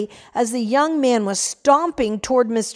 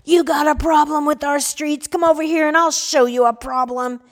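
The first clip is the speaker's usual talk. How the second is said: higher, louder furious taunt; irate shout; 5 semitones higher, 3 dB louder than usual. Acted. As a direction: yell it at strangers, spoiling for a fight. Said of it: "an irate voice shouted".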